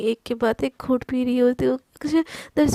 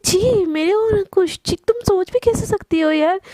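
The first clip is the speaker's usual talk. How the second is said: घिन भरा इनकार; 6 semitones higher than usual. Acted. Appalled friend denies.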